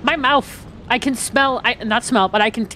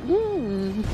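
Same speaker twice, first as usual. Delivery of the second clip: tiny, much softer cooing sound, like a cat; soft little cat coo; 4 semitones lower, 9 dB quieter than usual. Retold or imitated. Player mimicking an eager enemy as a cat, soft coo. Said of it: "He's like a little cat".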